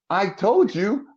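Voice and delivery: high-pitched protest to mother; exasperated son. Retold or imitated. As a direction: act out insisting to mom.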